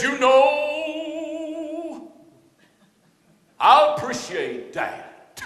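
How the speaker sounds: high, drawn-out preacher singsong; stretched, chanting mock-preacher voice; preacher's voice. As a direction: intone it like a hammed-up pulpit preacher. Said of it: "That's the way he talks".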